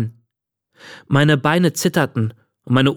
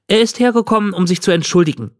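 put-on higher-pitched girl explaining; Miley, explaining; 6 semitones higher, faster than usual. A narrator's reading. Miley to the old man about the apology, eagerly explaining. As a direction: explain it for your friend in a higher voice.

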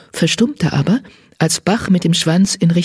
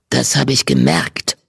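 put-on low old tom, trembling with suppressed fury; voice shaking with rage; 4 semitones lower than usual. A narrator's reading. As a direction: let the anger make the old voice quiver. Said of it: "seine Stimme bebte vor Wut". (tiefe, vor Wut bebende Stimme des alten Katers)

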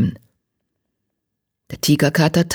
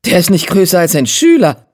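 louder, higher, scoffing disbelief; incredulous exclamation; 4 semitones higher, 6 dB louder than usual. A narrator's reading. Tigerstern astonished at the leader's small size, exclaiming. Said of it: "rief Tigerstern".

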